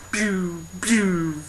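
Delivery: high, falling cartoon "pew" sounds; little soft zaps sliding down in pitch; imitated pinging noise. Imitates pinging fairies away with sword.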